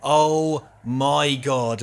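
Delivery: slow, loud, drawn-out oh my god; exasperated disbelief. Real, not acted. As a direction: stretch it out in disbelief. Reacting to a terrible performance.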